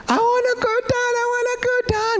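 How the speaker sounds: put-on very high-pitched panicked child shriek; imitated child's screaming. Father imitating his small child on a ride, high screaming. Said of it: "teriak-teriaknya sampai terdengar di Brisbane".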